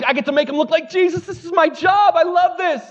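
high, excited gushing; giddy. Acted. Pastor acting out the Holy Spirit loving his work, giddy. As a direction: burst with delight at the job, pitched high. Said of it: "he's like, he's giddy".

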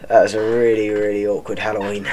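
normal talking voice

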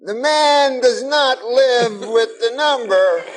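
high, slow put-on Cosby voice; Bill Cosby impression. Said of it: "And he said".

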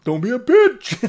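high, loud mocking quote; mock nagging voice. People he knows scolding him, high mocking voice.

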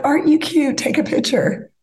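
high-pitched mock gushing over a couple; cooing photographer. Photo crew to a couple posing together, mock gushing. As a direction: coo it in a high voice, like you're egging them on.

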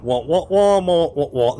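high garbled droid wah wah; muffled wah wah talk. Acted. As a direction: babble muffled wah sounds. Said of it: "So he's like".

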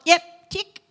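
high, loud quick tick-off; a bright mental checkmark; delighted inner approval; approving. Retold or imitated.

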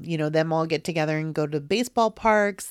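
usual speaking voice

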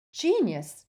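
loud high cry of delight; yelling. Acted. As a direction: cheer the news. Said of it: "yelled Harry".

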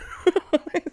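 a burst of high laughter. Real, not acted. Host laughing at Biden's gaffe, high laugh.

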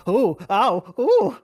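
high, loud mock "oh, ow"; comic pained yelps. Imitating Randy getting killed in the van, comic yelps. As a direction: yelp as if hammily being stabbed. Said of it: "But he's just like".

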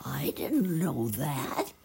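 slow, hushed wonder with a rising end; surprised. A narrator's reading. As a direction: draw it out in breathy amazement. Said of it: "eyes were round with surprise".

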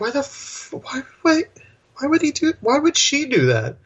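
high-pitched exasperated questioning; incredulous. Retold or imitated. Retelling his reaction to the film, baffled and incredulous. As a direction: sputter in disbelief, restarting the question.

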